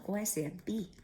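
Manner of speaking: ordinary delivery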